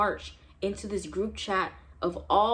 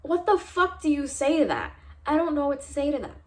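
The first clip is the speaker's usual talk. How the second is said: outraged question; 6 semitones higher than usual. Real, not acted. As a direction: throw it out in outrage. Outraged at professor.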